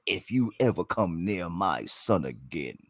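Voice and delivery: deep, low, quiet threat; Mufasa. Retold or imitated. Co-host impersonating Lion King father, deep menacing voice. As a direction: imitate a big deep-voiced father lion warning someone off. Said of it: "then there's Mufasa".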